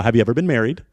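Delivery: ordinary delivery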